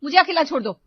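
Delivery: wife refusing to eat, loudly upset; angry refusal. Acted. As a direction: push them away, hurt and angry. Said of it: "वो गुस्से में अपने कमरे में चली गई".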